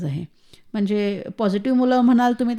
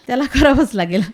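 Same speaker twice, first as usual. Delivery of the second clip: a laugh breaking into the repeat; 5 dB louder than usual. Real, not acted. Mother to interviewer about her son, laughing. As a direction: laugh through it, amused and firm.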